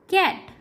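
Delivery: कैट opening on क, pitched high. Shown as a demonstration. Teacher modelling hard C for learners, high कैट with क. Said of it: "क के रूप में भी".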